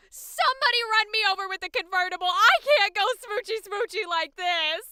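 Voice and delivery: loud, fast melodramatic whine; dramatic wail. Acted. Dramatic despair.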